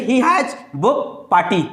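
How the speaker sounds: every word spaced out for copying; slow, clear teacher dictation. Shown as a demonstration. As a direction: read it slowly so students can write. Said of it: "ठीक है ना?".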